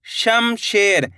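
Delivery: Shamsher stretched slowly, syllable by syllable; slow teacher blending; slow sounding out. Shown as a demonstration. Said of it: "शेर बोलेंगे".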